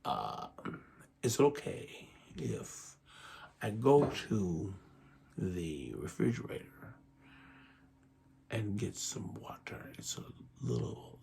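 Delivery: hushed, breathy whisper of an odd coworker asking a favor. Retold or imitated. Coworker whispers asking to get water from the fridge. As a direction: lean in and whisper the request. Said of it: "And he's like".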